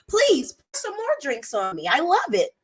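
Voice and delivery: high-pitched mock pleading; mock delight. Pretending to welcome a thrown drink, mock delight. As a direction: gush with sarcastic, pretend enjoyment.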